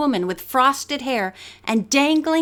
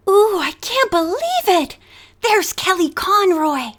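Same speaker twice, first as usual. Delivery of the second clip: excited whisper; 7 semitones higher than usual. A narrator's reading. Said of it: "Bess whispered excitedly".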